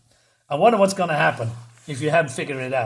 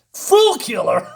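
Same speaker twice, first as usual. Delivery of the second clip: much higher, scoffing echo; mocking disbelief; 7 semitones higher, 4 dB louder, slower than usual. Mailroom guard scoffs at the Fool Killer, high and disbelieving. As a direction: repeat it like it's a joke.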